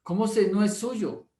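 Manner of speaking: a mildly imitated embarrassed wife insisting; flustered protest.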